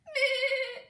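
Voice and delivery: loud, high bleating goat; a loud high goat's bleat. Acted. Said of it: "a goat who was bleating loudly".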